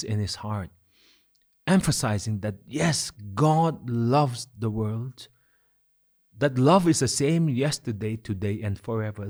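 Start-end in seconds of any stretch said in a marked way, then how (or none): 2.7-5.3 slow, drawn-out stress on God; passionate emphasis. Acted. Preacher voicing Jesus to Nicodemus, slow and emphatic. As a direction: lean on every word with passion. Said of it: "with passion and with emphasis".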